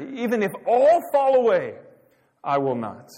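voicing a disciple's loud, high-pitched vow; bold declaration. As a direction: declare it high and loud, then drop firmly at the end. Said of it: "Peter declared".

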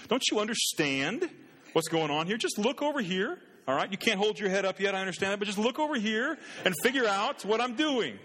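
put-on father reasoning with his crying six-month-old; exasperated dad. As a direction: coax the infant, half joking, half frazzled. Dad pleading with crying baby.

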